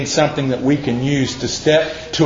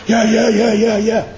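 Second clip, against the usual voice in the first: high, hurried mock yeahs; a frantic busy person brushing everything off; hyperdrive mock; 9 semitones higher than usual. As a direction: mimic someone racing on overdrive. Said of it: "on hyperdrive all the time going".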